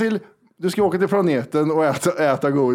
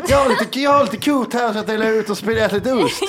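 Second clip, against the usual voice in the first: higher, laughing take on Kristianstad dialect; mock Kristianstad accent; 6 semitones higher than usual. Host teasing the guest about local speech, mock Kristianstad accent. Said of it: "Hur pratar de där då?".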